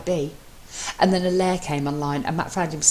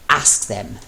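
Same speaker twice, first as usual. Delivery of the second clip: a firmer, louder order; commanding; 5 semitones lower, 4 dB louder than usual. Acted. Mello ordering his husband, louder commanding. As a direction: command it clearly. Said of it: "Mello commanded more loudly".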